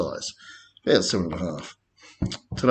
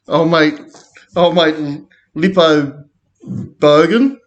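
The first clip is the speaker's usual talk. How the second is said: normal talking voice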